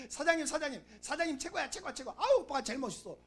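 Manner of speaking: high-pitched gushing flattery; mock flattering woman. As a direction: coo praise like a fawning girlfriend. Mimics woman flattering a rich man. (아양 떠는 여자 흉내, 높은 목소리로)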